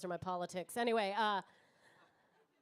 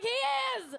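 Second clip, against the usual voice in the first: crowd shout; 11 semitones higher, 7 dB louder than usual. Real, not acted. Audience friends shouting.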